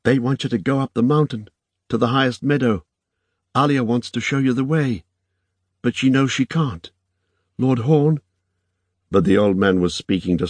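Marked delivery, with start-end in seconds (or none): 0.0-8.2 put-on high, earnest young woman's voice; Irene, earnest interpreter. A narrator's reading. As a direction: relay it in a higher, urgent voice.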